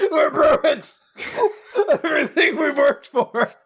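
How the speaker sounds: mock despair, high and laughing; despairing through laughter. A guy mourning their lost winnings, laughing mock despair. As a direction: wail it while cracking up. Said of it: "he's just like".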